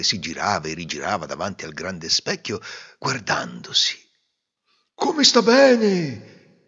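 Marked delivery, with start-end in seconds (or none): 4.9-6.7 slow, high, drawn-out gush of praise; admiring gush. Acted. Courtiers praising the emperor, slow high gushing. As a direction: swoon over the clothes. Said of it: "tutti dicevano".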